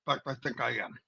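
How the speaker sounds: low quiet aside. Real, not acted. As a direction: drop into a low, soft aside. Self-mocking aside.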